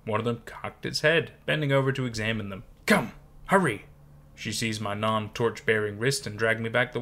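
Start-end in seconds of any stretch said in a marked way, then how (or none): 2.9-3.8 put-on a higher, urgent old woman's hurry; lightly voiced old woman, urging; urgent command. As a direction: hurry her along, lightly voiced crone. Old woman urging girl away.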